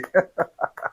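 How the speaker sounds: soft, rueful chuckle; rueful laugh. Acted. Old worker left clapping at back, rueful laugh. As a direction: laugh quietly at your own lot.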